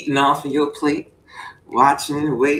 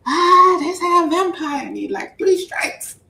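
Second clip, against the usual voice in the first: mock squeal; 12 semitones higher, 3 dB louder, slower than usual. Exaggerated reaction.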